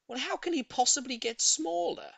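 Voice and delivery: high, puzzled woman; high lady voice. A narrator's reading. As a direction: wonder aloud, high and fussy. Voices Missus Silver.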